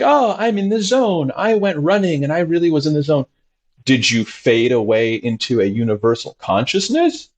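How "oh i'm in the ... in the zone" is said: high, breezy mock boast; mock jogger. Therapist mocking a runner who claims the zone, high and breezy. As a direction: tease a runner's zone talk.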